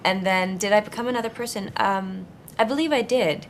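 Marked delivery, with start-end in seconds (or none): none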